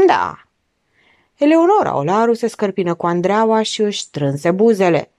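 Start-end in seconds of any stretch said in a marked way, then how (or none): 0.1-0.5 low, grudging one-word reply; reluctant. A narrator's reading. Doctor answering the frightened architect, reluctant and low. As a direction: answer as if it's a chore. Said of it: "răspunse în silă".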